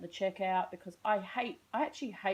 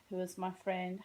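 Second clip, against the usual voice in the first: normal talking voice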